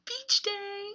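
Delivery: high, loud cheer of beach day; excited. Real, not acted. Greeting young viewers with a loud, high cheer.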